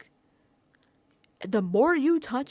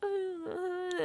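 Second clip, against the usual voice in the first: much higher, fake sobbing; mock crying; 9 semitones higher, slower than usual. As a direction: fake a tearful stammer. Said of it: "trying to do the Vince routine of crying".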